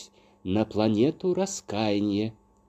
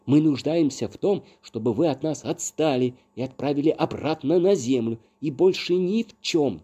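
raised, higher, insistent voice; angry retort; 5 semitones higher, 4 dB louder than usual. A narrator's reading. Accused man tells fairy to leave them alone, heated. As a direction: keep up the heated defiance.

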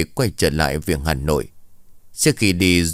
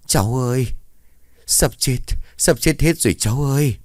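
put-on raised, wailing old woman's voice; frantic old grandmother; 5 semitones higher than usual. A narrator's reading. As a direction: cry out like a half-mad grandmother with a dire warning. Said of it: "bà liền nói".

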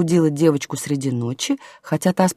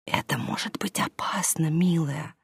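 very soft, hushed warning; cautioning whisper; 10 dB quieter than usual. A narrator's reading. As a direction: gently caution a child under your breath. Nanny's secret whispered reply to child.

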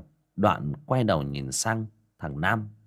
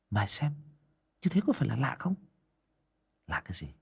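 whisper; 5 semitones higher, much faster than usual. Acted. Boys whispering together.

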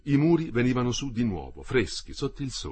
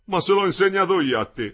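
higher, louder indignant retort; old worker snapping back at Metello, louder; indignant old man; 8 semitones higher, 6 dB louder than usual. Acted. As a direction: bristle at being lectured by a youngster.

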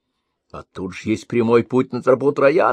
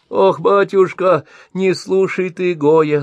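high, slow oohing granny voice; old woman fretting to the master, high and slow; old woman sighing and fretting; 9 semitones higher, 3 dB louder than usual. A narrator's reading. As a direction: fret and groan like an old woman. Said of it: "заохала старуха".

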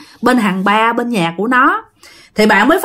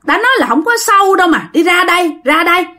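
1 insistent call; 7 semitones higher, faster than usual. Acted. Sinh insists from the water.

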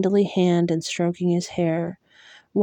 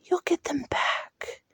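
whisper; 9 semitones higher, 6 dB quieter than usual. Acted. Promise to dying fairy.

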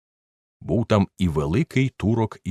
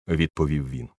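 ordinary delivery, nothing marked

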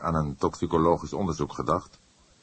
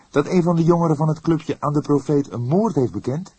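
put-on higher, louder surprised young voice; surprised question; 11 semitones higher, 5 dB louder than usual. Young detective surprised by the inspector's idea, asking. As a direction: ask in real astonishment. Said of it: "vroeg hij met enige verbazing".

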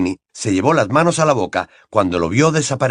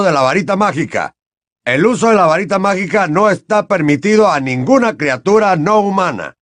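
higher, louder rule-citing official; officious Mr Diggory; 7 semitones higher, 4 dB louder than usual. Acted. Diggory citing the wand code.

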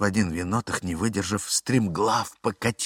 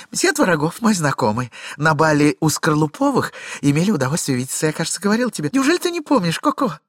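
high, loud, breathless excuses; chattering lady; 8 semitones higher, 6 dB louder, faster than usual. Acted. Glafira rattling off excuses to her husband, high and fast. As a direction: talk him dizzy with a tale.

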